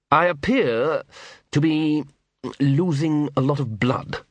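slow, unruffled lordly tone; calm lord stating his wound; dry aristocrat voice. A narrator's reading. Wounded patrician, dry understatement.

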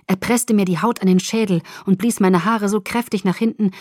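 ordinary delivery, nothing marked

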